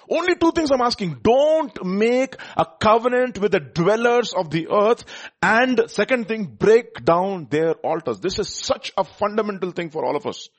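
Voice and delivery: urgent preacher laying down two demands; forceful preaching. Real, not acted.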